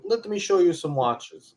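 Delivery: slow, put-on Russian accent. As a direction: imitate a Russian saleswoman. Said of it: "is that your best Russian accent?".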